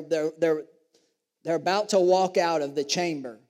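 normal talking voice

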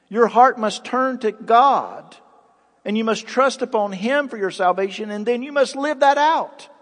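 Daniel pleading with the king to repent, preached fervently; fervent preaching. Acted. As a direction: urge repentance at full preaching force. Voicing Daniel's plea to king.

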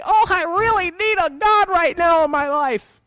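squeaky pretend infant voice; mock baby voice. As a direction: play the helpless baby asking for God. Preacher voicing a newborn baby.

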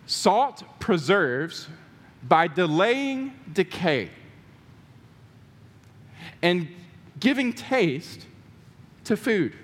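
slow, spaced definition for writing down; deliberate reading pace; slow for notes. Shown as a demonstration. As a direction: dictate each phrase slowly. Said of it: "it'll be on the screen here".

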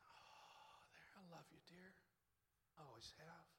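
put-on soft whisper to a little child; tender whisper. The Lord speaking to a little girl, soft tender whisper. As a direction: hush it gently to a small girl.

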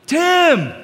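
calling out high and loud to be heard; raising voice on phone. Retold or imitated. Son shouting to his dad on the phone. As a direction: shout down a bad phone line. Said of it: "raising my voice".

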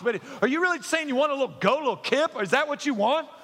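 high, loud incredulous mockery; mock disbelief. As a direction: ham up the disbelief at a goat. Mocking the brother's goat request.

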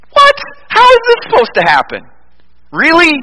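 high-pitched, stunned disbelief; incredulous. Acted. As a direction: blurt it out as if the news can't be true. Acts out Zechariah's disbelief at news.

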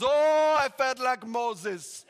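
put-on loud, high Ugandan accent, drawn out; excited Ugandan accent. Rescued Ugandan pastor retelling his ordeal, excited Ugandan accent. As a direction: exclaim it grandly in an imitated Ugandan accent. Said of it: "This is an awesome accent".

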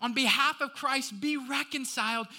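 high, drawn-out pleading scripture reading; impassioned preacher's plea; urgent appeal. Acted. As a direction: implore the congregation earnestly. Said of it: "Are you catching the urgency there from Paul?".

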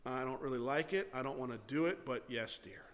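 low, soft, reluctant compliance; grudging inner voice. Acted. Heart's hidden reply to a spouse, grudging and low. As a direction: act out grudging agreement under the surface. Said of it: "what's my heart really saying?".

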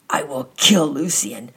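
slow, loud, harsh threat; cold threat. A narrator's reading. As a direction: promise revenge slowly and coldly. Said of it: "in a harsh, a hoarse voice".

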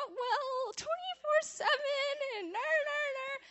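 high, whiny mock rambling; mock whiny babble. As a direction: parody your own nervous rambling. Mocking her own rambling pitch.

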